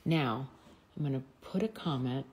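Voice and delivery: ordinary talk, nothing marked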